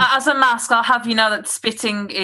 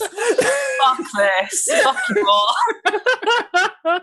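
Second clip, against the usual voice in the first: laughing mock outburst; 8 semitones higher, much slower than usual.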